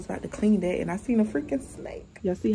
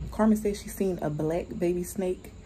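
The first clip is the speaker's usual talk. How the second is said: usual speaking voice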